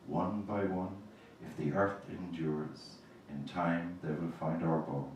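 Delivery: slow, grave elegy reading; poetry reading. Acted. Poet reads an elegy for her dead to the audience, gravely.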